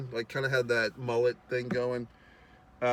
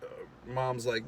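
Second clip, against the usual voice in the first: ordinary talk, nothing marked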